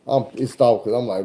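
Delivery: usual speaking voice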